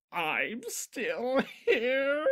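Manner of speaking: very high, slow disbelief; surprised. Acted. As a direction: squeak it out in disbelief. Discord startled.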